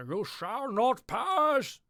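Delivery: booming, slow, high Gandalf command; slow, loud McKellen proclamation; Ian McKellen. Retold or imitated. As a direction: thunder it out like the wizard on the bridge. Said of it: "Ian McKellen".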